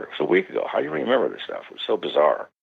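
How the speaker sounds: normal talking voice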